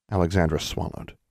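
ordinary delivery

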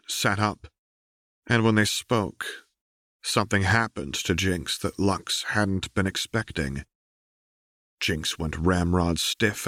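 ordinary delivery, nothing marked

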